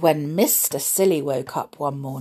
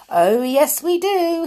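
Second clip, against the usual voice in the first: high loud realization; excited cry; 10 semitones higher, 5 dB louder than usual. Acted. Mister Silly having an idea, excited cry. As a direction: burst out with the idea. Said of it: "he cried".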